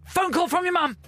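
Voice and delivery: shouting. Retold or imitated. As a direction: yell it across the court. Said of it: "then I'd shout".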